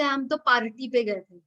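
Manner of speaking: "party" as PAR-ti, R clearly sounded; regional prominent R. Retold or imitated. Teacher quotes people in India, prominent R. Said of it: "The R is a very prominent sound".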